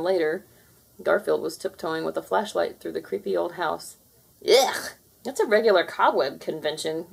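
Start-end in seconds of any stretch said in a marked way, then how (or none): none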